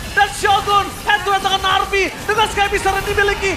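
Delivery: excited esports caster calling the fight; rising hype commentary; live play-by-play. Real, not acted.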